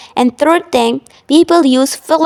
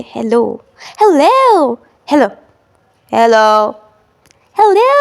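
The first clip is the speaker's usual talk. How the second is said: each hello in a new emotion; varied emotional hellos; slower than usual. Shown as a demonstration. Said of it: "if I say something very emotionally".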